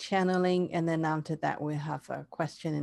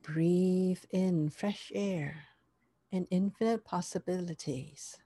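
guided meditation; 3 dB quieter than usual. Acted.

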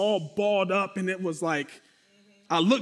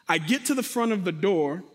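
normal talking voice

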